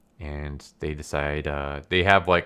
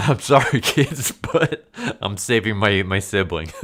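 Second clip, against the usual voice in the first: laughing mock apology, pitched up; 4 semitones higher, 6 dB louder than usual. Real, not acted. Jokingly apologizing to kids for choosing a sibling, laughing. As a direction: say it loud through giggles.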